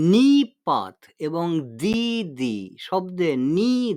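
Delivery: slow, with নি held long; drawn-out নি. Shown as a demonstration. Teacher showing a drawn-out নি, slow and held. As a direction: stretch the নি in নিপাত and দিদি. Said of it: "টেনে উচ্চারণ করছি".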